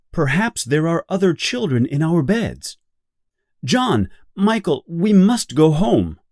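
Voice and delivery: put-on rally the boys to go home now; urgent. Wendy urges John and Michael home, urgently.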